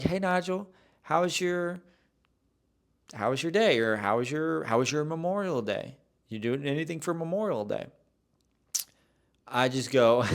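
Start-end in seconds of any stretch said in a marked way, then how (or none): 0.0-7.9 lightly voiced barista, pitched up; casual small-talk greeting, lifted pitch; friendly barista small talk. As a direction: imitate a chatty barista, a bit higher. Voicing baristas making small talk.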